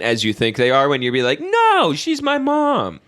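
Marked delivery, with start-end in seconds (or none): 1.4-3.0 high-pitched, drawn-out protest; mock protest. Playing someone defending moving mom in, high mock protest. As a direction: whine it up high, slowing down.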